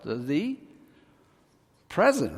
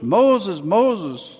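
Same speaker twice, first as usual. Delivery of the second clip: loud booming call from the bush; God calling out; 4 semitones higher, 7 dB louder than usual. Acted. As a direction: call his name across the distance.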